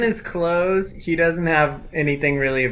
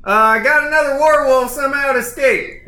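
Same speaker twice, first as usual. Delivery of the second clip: raised, high, quick report of trouble; alarmed bandit yell; 9 semitones higher, 5 dB louder than usual. Acted. Bandit reporting an escaped werewolf to others, alarmed yell. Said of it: "He's like".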